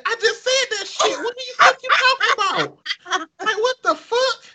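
very high, squealed mock indignation; exasperated outburst. Retelling being ignored by teammates, squealed exasperation. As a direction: protest it in a shrieky falsetto.

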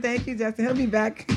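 ordinary delivery

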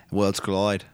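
put-on British accent that comes out Australian; accidental Australian accent. Host imitating a British wrestler's tweet, wrong Australian accent. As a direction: attempt British, drift into Australian. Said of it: "That's Australian, I'm sorry".

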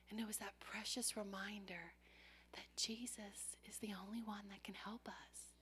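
soft unvoiced hushed words; reverent whisper. Real, not acted. Preacher confiding to congregation in a reverent whisper. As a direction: share it in a hush.

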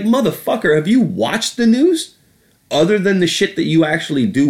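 high, incredulous rant; exasperated outburst. Real, not acted. Comic ranting at Trump, incredulous and exasperated. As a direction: jab the question with mock disbelief.

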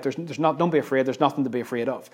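quick, soft throwaway reassurance; wheeled-out platitude. Retold or imitated. Imitating people offering stock comfort, quick and offhand. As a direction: toss it off quickly and quietly. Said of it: "We just sort of wheel out".

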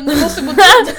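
loud, high mock-angry outburst; imitated furious staffer. Traveller mimicking the staffer sending them away, imitated fury. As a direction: imitate an angry clerk, laughing through it. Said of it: "hon blev typ skitarg".

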